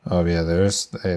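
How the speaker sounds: ordinary talk, nothing marked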